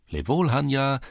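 high singsong calling-out; called farewell. A narrator's reading. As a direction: call goodbye with the voice lifted. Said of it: "rief in diesem Augenblick einer der Burschen".

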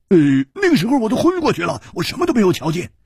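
高而亮的粗豪汉子嗓音，感慨不已; 又佩服又唏嘘地嘟囔; 胡铁花的声音. Acted. 胡铁花回答. (高亢的胡铁花感叹)

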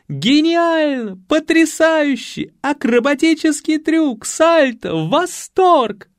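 very high, drawn-out ecstatic cries; восторженные крики дам. Acted. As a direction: gush like delighted ladies in the stands. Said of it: "дамы закричали". (восторженно кричать высоким голосом)

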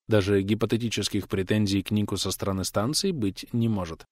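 plain reading voice

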